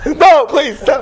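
high-pitched, loud pretend begging; mock plea. Rabbi joking with his audience, mock plea. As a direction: beg them to stop, half laughing.

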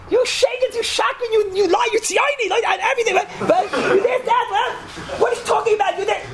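very high, hammed-up screaming fit; mock screaming. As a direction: imitate the disbelieving wife screaming accusations. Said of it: "And she screams".

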